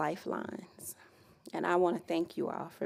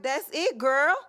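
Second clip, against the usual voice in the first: grandma's approval; 7 semitones higher, 5 dB louder, slower than usual. Retold or imitated. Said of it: "She was like".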